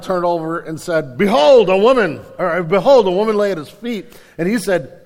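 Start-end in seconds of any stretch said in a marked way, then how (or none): 1.2-4.1 loud startled cry. Retold or imitated. Boaz startled awake at midnight, loud startled cry. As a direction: blurt it out as if jolted awake.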